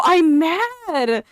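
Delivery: ordinary talk, nothing marked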